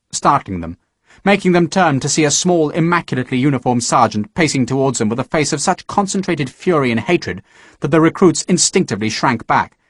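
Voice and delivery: ordinary talk, nothing marked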